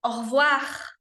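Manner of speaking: au revoir com o E caído, quase o voa; E caduc. Shown as a demonstration. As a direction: diga au revoir como nativo. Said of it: "escutá-los falarem". (au revoir com E caduc)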